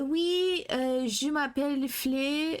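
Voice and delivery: slow, hammed-up French accent; mock French accent. If he was from Fontaine, mock French accent. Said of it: "can you imagine if he was from Fontaine?".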